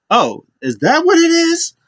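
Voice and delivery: high-pitched mock disbelief; incredulous question. Wrestler reacting to an odd excuse, incredulous. Acting out Roman's amused disbelief.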